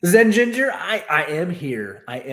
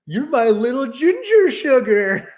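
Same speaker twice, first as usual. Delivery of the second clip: higher, louder, slow cooing voice of the wife; imitating sweet wife; 11 semitones higher, 9 dB louder, slower than usual. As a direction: coo it like a doting wife. Wife's sweet tease retold.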